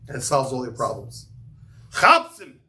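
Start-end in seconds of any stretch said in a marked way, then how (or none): 1.9-2.6 Chapsim called out higher and louder in a thick Yiddish accent, with a guttural ch. Shown as a demonstration. Rabbi showing students the Yiddish call for help, Yiddish accent. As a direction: bark Chapsim in a Yiddish accent, like rallying the guys. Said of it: "You gotta say it with the right, right? Yiddish accent".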